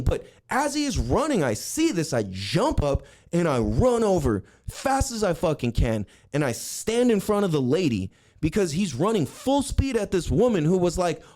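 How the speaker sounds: ordinary delivery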